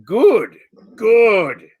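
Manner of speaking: put-on a giantess vouching for the group, loud and childlike; giantess broken speech. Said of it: "she says".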